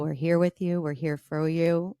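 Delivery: ordinary talk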